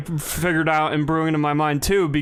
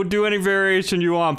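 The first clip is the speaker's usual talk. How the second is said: usual speaking voice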